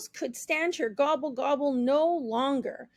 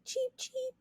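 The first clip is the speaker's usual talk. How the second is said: put-on high, soft baby-chick peep; a baby chick's soft cheep; 12 semitones higher, 9 dB quieter than usual. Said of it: "soft and fuzzy".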